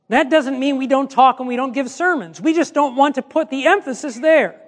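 ordinary delivery

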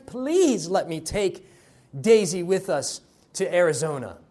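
ordinary talk

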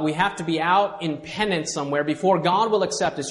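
ordinary delivery

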